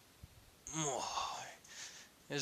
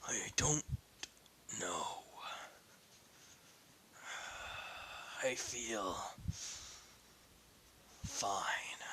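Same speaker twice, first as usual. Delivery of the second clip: much softer, lower, faltering; broken whisper; 3 semitones lower, 12 dB quieter, much slower than usual. Acted. Ignatius admitting nothing to friends, barely whispered. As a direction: barely get the words out.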